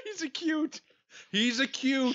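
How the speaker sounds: squeaky high words through laughter; can barely get it out for laughing. Real, not acted. Laughing fit over a mouse.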